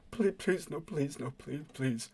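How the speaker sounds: soft, pleading whimper. Acted. Defeated man begs not to be set alight, whimpering. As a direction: beg in a small, frightened whimper. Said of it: "he starts to whimper".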